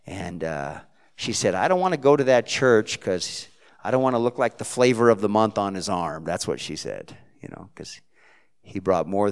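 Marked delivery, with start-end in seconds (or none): 1.6-6.2 put-on Jersey accent. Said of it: "She's got a Jersey accent".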